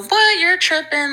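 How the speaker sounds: a sharp, high, scoffing what; incredulous what. Retold or imitated. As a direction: act stunned that they'd doubt you. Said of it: "And I go".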